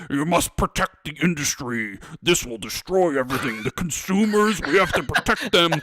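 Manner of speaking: pompous Nixon-like exec; Richard Nixon impression. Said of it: "why is Jim Ryan Richard Nixon".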